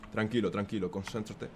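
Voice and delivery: very soft, low murmur; calm yourself down under your breath; hushed self-soothing. Real, not acted. Player calming himself to focus, hushed self-soothing.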